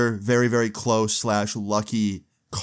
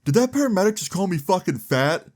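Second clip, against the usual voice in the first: retold indignant yell; 7 semitones higher than usual. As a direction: act out a friend yelling in disbelief. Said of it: "he yells out".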